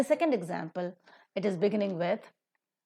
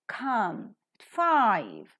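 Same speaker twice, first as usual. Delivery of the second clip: very slow, come aspirated, five stressed, at weak; slow model with weak at; 3 semitones higher, 3 dB louder, much slower than usual. Shown as a demonstration. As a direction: spell it out slowly, at reduced. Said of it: "Five is aspirated because, five is stressed".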